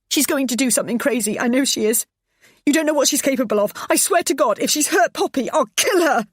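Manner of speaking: high, loud frantic rant; frantic threats. Acted. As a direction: rush out hysterical threats. Frantic wife in a row.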